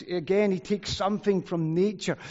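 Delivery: normal talking voice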